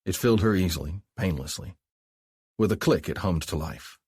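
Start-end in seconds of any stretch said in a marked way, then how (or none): none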